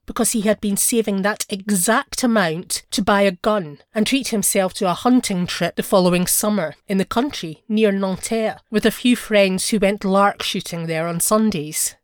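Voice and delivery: plain reading voice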